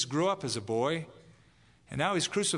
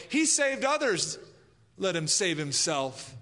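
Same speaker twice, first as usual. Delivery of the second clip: mocking taunt; 5 semitones higher than usual. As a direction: throw the words up at him with scorn. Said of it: "hear the accusers from the ground say".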